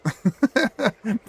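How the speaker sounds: high laugh at her own rant. Real, not acted. Laughing at herself while telling a friend, bright laugh. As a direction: burst out laughing.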